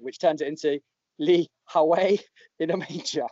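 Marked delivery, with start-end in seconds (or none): 1.2-3.2 put-on Northeast English accent, slightly lifted. Shown as a demonstration. Podcaster showing the pun only works in a Northeast accent. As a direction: say it like a Geordie, "Howie" as "ha-way". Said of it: "say it in a Northeast accent".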